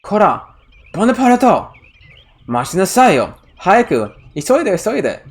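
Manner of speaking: high sharp scold in Japanese; scolding the runaway dog, high and insistent; angry scolding. Retold or imitated. A girl scolding Bonaparte to hurry, in a high angry voice. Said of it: "She sounds more angry in Japanese".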